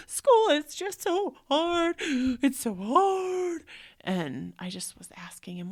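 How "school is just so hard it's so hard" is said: put-on high, slow, loud sobbing kid voice; crying child. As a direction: wail it like a heartbroken boy. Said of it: "he was like".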